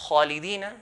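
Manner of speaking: "khalidina" with a thick, heavy "kha". Shown as a demonstration. Teacher giving an example word, heavy "khalidina". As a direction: raise the back of the tongue on the start of "khalidina". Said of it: "in a heavy manner".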